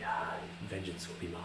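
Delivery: very soft, hushed vow of revenge; hushed, ghostly threat; imitated whisper. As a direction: breathe it faintly as a departing spirit. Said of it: "I'm gonna whisper into his ear".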